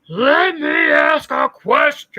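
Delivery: loud, high, very slow mock shout; mocking her voice. Mocking the woman who asked on the panel, hammed-up yell. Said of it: "let me try to do her voice".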